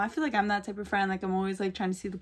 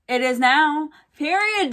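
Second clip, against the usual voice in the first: louder imitated sassy comeback; Maddie's comeback; 7 semitones higher, 10 dB louder than usual. Maddie shutting Lexi down, loud mock sass. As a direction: hit it like a winning line, hammed-up. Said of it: "And then Maddie goes".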